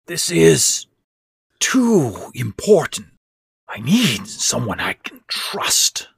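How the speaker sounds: hoarse voice. A narrator's reading. Sick stepfather insisting to Larry, hoarse and urgent. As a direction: rasp it out urgently. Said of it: "Ben said in a hoarse voice".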